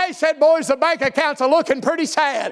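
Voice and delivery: driving revival sermon cadence; full-force preaching. Real, not acted. Preacher rallying church to spread good news, full-force preaching. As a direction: preach it hard, stretching the big phrases.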